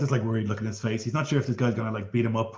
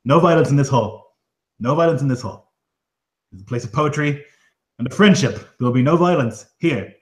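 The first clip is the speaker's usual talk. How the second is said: higher, louder, pleading host; a nervous hall keeper begging for peace; flustered host; 4 semitones higher, 6 dB louder than usual. Acted. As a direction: rush to calm the hall down. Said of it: "the other man stands up and he goes".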